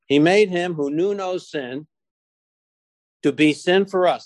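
measured, emphatic scripture reading; slow reading. Real, not acted. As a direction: read it out slowly, pausing between phrases. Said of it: "I'll read it real slowly here".

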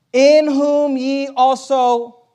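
very slow, weighted scripture read; preacher reading Ephesians slowly. Acted. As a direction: draw the verse out word by word. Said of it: "look at this word".

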